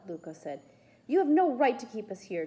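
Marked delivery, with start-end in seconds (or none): 1.1-2.4 high, loud protest; defiant. A narrator's reading. Boy challenging his captor, defiant. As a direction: stand up to him, raised and defiant. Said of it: "Julius said defiantly".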